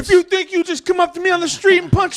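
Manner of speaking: high, loud tough-guy rapper shout; gangster rapper. Pretend. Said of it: "He should do a gangster rap album".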